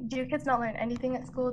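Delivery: ordinary delivery, nothing marked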